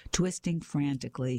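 ordinary delivery

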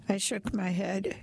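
ordinary delivery

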